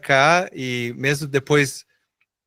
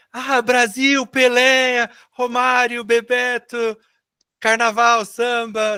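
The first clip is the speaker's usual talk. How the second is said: mock gushing fan; 8 semitones higher, much slower than usual. Americans gushing about Brazil.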